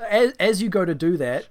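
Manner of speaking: ordinary delivery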